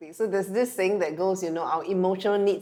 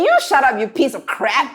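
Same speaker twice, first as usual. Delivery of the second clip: louder and higher, mock furious shout; escalating mock shout; 7 semitones higher, 10 dB louder than usual. Wife shouting back at her husband, louder and higher. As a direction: top his insult and raise the stakes. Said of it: "And I say".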